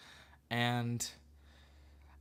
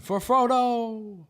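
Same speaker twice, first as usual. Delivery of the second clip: high, loud, slow war cry; drawn-out heroic yell; battle cry; 12 semitones higher, 9 dB louder, much slower than usual. Retold or imitated. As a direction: shout it like charging into battle. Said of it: "He was the one that went".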